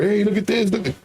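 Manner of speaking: high, excited call to a spouse; acted-out happy outburst; happy showing off. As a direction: play someone eager to show a win. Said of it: "you don't get happy about it".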